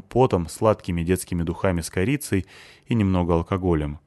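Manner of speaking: plain reading voice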